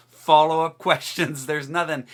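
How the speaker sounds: usual speaking voice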